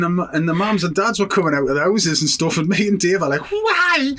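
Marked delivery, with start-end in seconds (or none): 3.5-4.1 very high squealed imitation; mock yelp. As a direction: shoot right up into a comic squeal. Riders' mock yelp in story.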